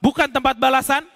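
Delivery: rising, forceful reproach from the pulpit; preaching rebuke. Acted. Preacher voicing God's answer to the damned, stern reproach. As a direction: scold the audience as God answering.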